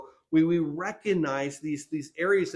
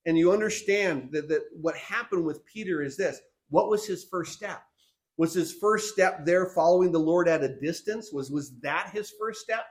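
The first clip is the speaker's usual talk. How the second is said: normal talking voice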